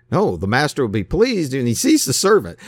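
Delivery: usual speaking voice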